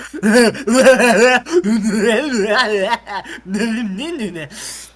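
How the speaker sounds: loud cartoon-beast gibbering; Tasmanian devil gibberish. Playing George watching the movie, Tasmanian devil gibbering. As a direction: sputter wildly like the Tasmanian devil. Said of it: "George turned to the Tasmanian devil".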